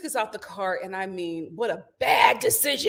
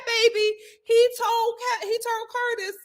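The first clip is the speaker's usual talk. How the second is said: usual speaking voice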